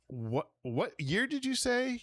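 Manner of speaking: sputtering surprise. Acted. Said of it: "you sputter".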